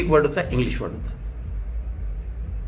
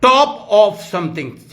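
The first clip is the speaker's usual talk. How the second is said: slow emphasis; much slower than usual. Shown as a demonstration. Preacher defining the word, very slow. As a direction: top of something said very slowly. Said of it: "इपी का मतलब है".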